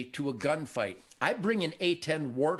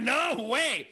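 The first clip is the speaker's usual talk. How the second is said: higher-pitched, emphatic retort; emphatic refusal; 7 semitones higher than usual. Retold or imitated. Kid refusing older guys' beer, emphatic refusal. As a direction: turn it down flat out, pitch up. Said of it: "And I went".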